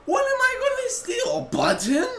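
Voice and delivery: high, loud incredulous squawk; mock-outraged disbelief; indignant retort. Acts out indignant retort to accusation.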